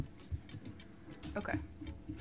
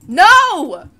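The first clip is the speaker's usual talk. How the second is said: high, very loud despairing shout; 8 semitones higher, 19 dB louder than usual. Real, not acted. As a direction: cry out as you die. Dying in-game.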